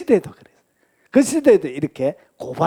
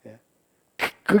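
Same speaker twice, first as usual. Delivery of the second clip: ordinary delivery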